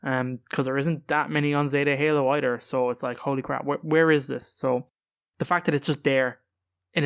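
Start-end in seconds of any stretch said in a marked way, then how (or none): none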